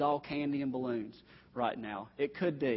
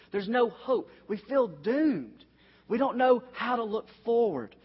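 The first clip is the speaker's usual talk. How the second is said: despairing; 7 semitones higher, 3 dB louder than usual. Acted. Preacher voicing hopeless feelings.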